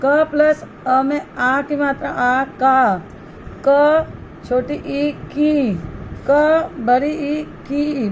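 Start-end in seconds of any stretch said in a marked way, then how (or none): 3.7-8.1 each matra drawn out slowly; slow spelling out. Shown as a demonstration. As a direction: spell it out slowly for young pupils. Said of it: "teacher spells matra forms".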